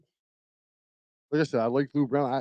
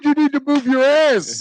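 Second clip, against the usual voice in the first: much higher, louder, slow put-on trainer bark; Mickey yelling; 10 semitones higher, 7 dB louder than usual. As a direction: belt it like a grizzled old trainer pushing his boxer. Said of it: "He's like".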